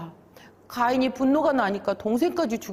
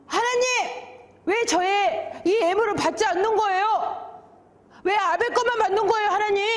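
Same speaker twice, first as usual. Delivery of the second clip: high-pitched indignant complaint; angry protest; 11 semitones higher than usual. Acted. Cain protesting to God over his rejected offering, acted high angry outburst. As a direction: demand an answer, pitch pushed right up. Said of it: "하나님께 막 화를 내는 거예요".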